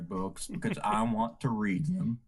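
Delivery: deep, low narrator impression; Morgan Freeman. Game master introducing another of the voices, deep impression. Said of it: "and I'm Morgan Freeman".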